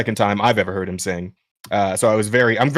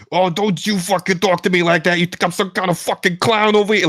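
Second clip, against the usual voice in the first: put-on a light New York bar-hopper accent, gruff and picked a fight; drunk New York barfly; 8 semitones higher than usual. Retelling the old Husk voice actor, a lightly done angry New York drunk. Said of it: "kinda sounded like a drunk, angry, like, New York bar hopper".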